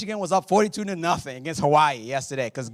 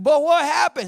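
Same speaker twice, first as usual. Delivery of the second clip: eager crowd; 11 semitones higher, 10 dB louder than usual. Retold or imitated. Crowd demands again.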